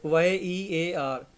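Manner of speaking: very slow, letter by letter; careful letter-by-letter; slow spelling. Shown as a demonstration. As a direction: spell it out for notes. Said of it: "spelling out letters slowly".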